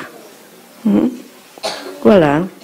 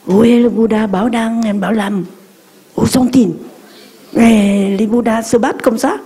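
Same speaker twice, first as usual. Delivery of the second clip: mildly raised scolding mother's voice; a hint of a cross mother; 4 semitones higher than usual. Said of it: "Alors maman a dit". (la maman qui gronde, voix un peu plus haute)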